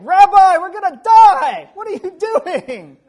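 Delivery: high, loud, slow terrified yell; panicked shout. Shown as a demonstration. Disciples waking Jesus in the storm, panicked shouting. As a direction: scream it like the boat is sinking. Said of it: "It's probably more like".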